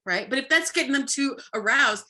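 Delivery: normal talking voice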